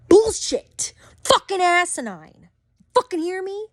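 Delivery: outraged yelling high; high angry burst at a stranger; angry outburst. Real, not acted. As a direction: spit out the insults in outrage. Ranting at online critic.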